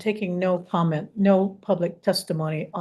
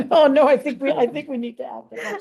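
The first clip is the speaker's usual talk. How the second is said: laughing; 4 semitones higher, faster than usual. Real, not acted. Laughing at the late arrival.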